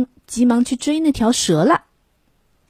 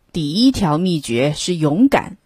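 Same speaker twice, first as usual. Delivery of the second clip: usual speaking voice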